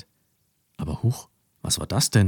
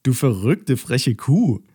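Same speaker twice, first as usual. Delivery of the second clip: teasing laugh; 4 semitones higher, 4 dB louder, slower than usual. A narrator's reading. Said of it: "lachte Mathilda".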